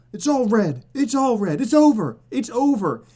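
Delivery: loud, high doom-crying; mock panic shout. Streamer yelling at chat that crypto is over. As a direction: shout the crash like the world is ending.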